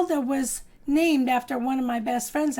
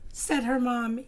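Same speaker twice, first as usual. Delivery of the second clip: ordinary delivery, nothing marked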